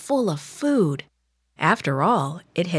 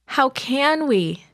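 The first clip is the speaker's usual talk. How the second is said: high, slow, whiny protest; a doubtful spy moaning back; complaining; 8 semitones higher, slower than usual. Acted. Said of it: "the ten discouraged spies complained".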